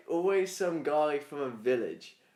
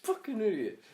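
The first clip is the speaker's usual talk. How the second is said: slow, higher alien grumble; annoyed alien; 7 semitones higher, slower than usual. Pretend. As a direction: curse at the tape like a frustrated alien. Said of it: "Obviously, in alien language".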